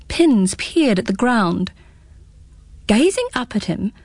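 usual speaking voice

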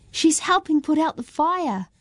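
excited shout. A narrator's reading. Girl telling friends dragon helps, excited. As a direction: burst out with delight. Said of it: "shouted Ming in excitement".

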